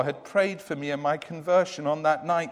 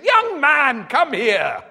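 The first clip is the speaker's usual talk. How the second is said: put-on high, louder old woman's interruption; old lady calling; 9 semitones higher, 9 dB louder, slower than usual. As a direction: cut in like an insistent old lady. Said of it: "I continued firmly".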